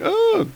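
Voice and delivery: high startled cry; mock scream. Woman attacked by beast, high mock scream. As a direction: yelp like a frightened woman.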